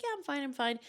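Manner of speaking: quick, falsely cheery reassurance; forced cheerful denial. Retold or imitated. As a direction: pretend you're fine while holding back tears. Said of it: "And I'm like".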